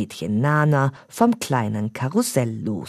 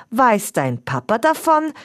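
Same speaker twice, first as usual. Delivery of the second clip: questioning uncle; 9 semitones higher than usual. A narrator's reading. Uncle asks Stefan.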